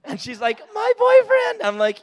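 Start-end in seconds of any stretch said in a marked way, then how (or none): 0.7-1.6 put-on much higher, squeaky girly voice; imitating a girl. Girl at school answers him in a high chirp. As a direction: chirp it like a proud little girl.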